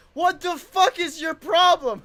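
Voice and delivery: high, loud mock outrage; retold exasperation. Card lady fed up with confusing answers, mock exasperated yell. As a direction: imitate her losing patience, loudly.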